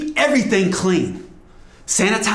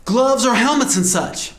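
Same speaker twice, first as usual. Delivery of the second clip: ordinary talk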